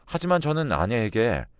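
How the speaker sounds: ordinary delivery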